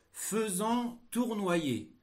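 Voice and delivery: slow, high dictation voice; dictating. Shown as a demonstration. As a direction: dictate slowly and clearly. Dictation of text chunk.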